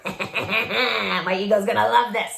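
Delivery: mock-gleeful, slow relish with a chuckle; slow, playful gloat. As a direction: drag it out with gloating delight. Voicing gleeful inner reaction to challenge.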